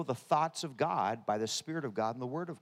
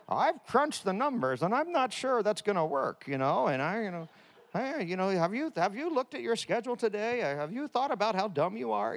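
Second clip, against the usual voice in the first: accountant voice; 6 semitones higher than usual. Retold or imitated. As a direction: play the fussy doubting accountant. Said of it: "Everybody has a little accountant".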